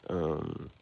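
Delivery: usual speaking voice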